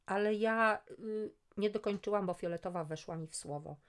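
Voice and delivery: ordinary delivery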